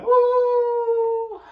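high, loud excited cheer; excited woo. Real, not acted. Teacher whooping to the class after naming exam material. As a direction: let out a big whoop.

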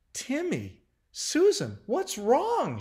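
high-pitched worried questioning; alarmed mother. A narrator's reading. Mom finding the children sobbing, alarmed. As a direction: burst in calling each name, worried.

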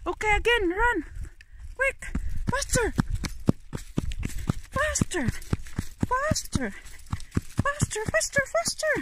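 high-pitched greeting and urging; high, delighted calls to a pet; excited pet talk. Real, not acted. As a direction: squeal encouragement at the running dog. Greeting, urging on running dog.

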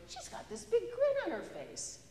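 usual speaking voice

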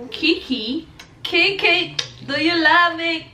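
high, slow cooing. Real, not acted. Cooing at baby.

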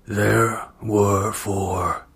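very slow, eerie utterance; slow grave voice. A narrator's reading. Stranger telling the group, slow and grave. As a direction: let it hang like a ghost.

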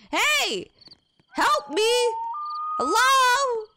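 high, loud call for help. Acted. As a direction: yell out to the others. Stuck pushing truck alone.

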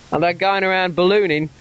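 usual speaking voice

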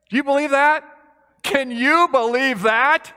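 loud, high, thrilled proclamation; excited shouting. Acted. David shouting his joy to the world, loud and high. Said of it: "It's almost like he's shouting this for the world to hear".

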